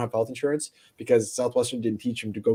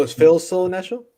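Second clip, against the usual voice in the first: louder, clearer repeat; host repeats the question louder to the guest; 7 semitones higher, 7 dB louder than usual. Real, not acted. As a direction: say it again louder to clear up confusion. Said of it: "are we in Nashville still?".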